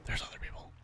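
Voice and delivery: "There's other people" repeated in a breathless whisper; hushed whispered echo. Real, not acted. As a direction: whisper it as if not to be overheard. Repeats the discovery under breath.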